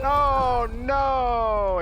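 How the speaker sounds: loud high shouts of no; panicked shout. Real, not acted. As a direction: yell it as the play goes wrong. Panicked gaming moment, shouting.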